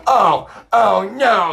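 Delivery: high, loud, drawn-out mock dismay; mock alarm. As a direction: wail it slowly, mocking their horrified reaction. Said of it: "And they're like".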